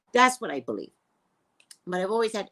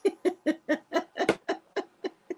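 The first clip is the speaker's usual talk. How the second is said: high burst of laughter; 9 semitones higher than usual. Real, not acted. Laughing at clone idea.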